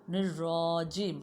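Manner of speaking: نرجیم drawn out slowly with a clear r; teacher's model word; correct pronunciation. Shown as a demonstration. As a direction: stretch out نرجیم, clean r. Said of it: "رو کی آواز نکالو گے".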